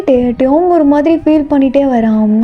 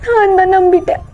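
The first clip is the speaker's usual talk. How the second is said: acted crying; 7 semitones higher than usual. Heartbroken girl taking the blame to her friend, crying. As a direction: wail it through tears. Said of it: "இப்ப நீ ஏன் அலற".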